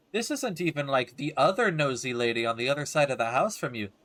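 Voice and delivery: ordinary delivery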